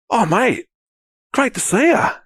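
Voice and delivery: high, eager hello from a stranger; excited greeting. Retold or imitated. Stranger greeting him at the club, high and eager. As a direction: greet him like a long-lost mate.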